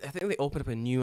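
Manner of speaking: normal talking voice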